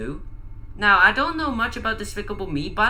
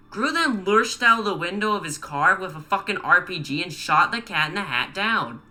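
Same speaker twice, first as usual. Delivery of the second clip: ordinary delivery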